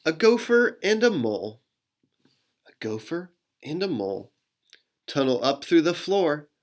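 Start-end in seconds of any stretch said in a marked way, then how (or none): 0.0-1.6 lifted, brighter reading; higher. Shown as a demonstration. Rereads line in two ways. 2.7-4.3 faster, quieter reading; quick and softer. Shown as a demonstration. Rereads line in two ways.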